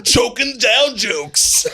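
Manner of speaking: stretched-out slow teasing line. Pretend. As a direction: drag it out to rib Dan. Teasing Dan.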